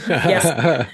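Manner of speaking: low-pitched mock soldier reply; military man. Military pacer keeps answering the runner, lightly imitated low voice. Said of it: "very military and everything".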